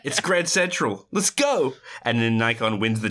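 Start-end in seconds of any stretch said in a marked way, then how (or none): 0.0-1.7 higher, stretched excited call; rally a friend to dash off; Serial. Retold or imitated. Hacker just paged by Kate, high eager call. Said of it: "what does Serial always say".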